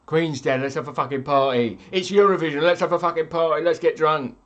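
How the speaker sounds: loud, rowdy mock party cheer; rowdy partygoer. Streamer mocking British partygoers, rowdy loud cheer. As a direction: shout it like a drunk Brit chanting.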